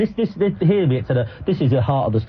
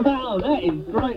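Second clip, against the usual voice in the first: slow, softer words squeezed out through laughter; laughing; 6 semitones higher, 5 dB quieter, slower than usual. Real, not acted. Host helpless with laughter at the epitaph. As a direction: laugh so hard the words barely come.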